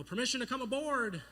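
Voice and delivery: high, loud call up to a ship; yelling up. Retold or imitated. Boy in the sand pit to pirate captain, yelling up. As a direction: holler up for permission. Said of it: "I yell up and I go".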